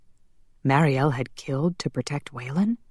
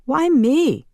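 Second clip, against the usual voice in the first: high, louder squawk of objection; indignant protest; 11 semitones higher, 5 dB louder than usual. Acted. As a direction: protest at being picked. Passing an unwanted task along.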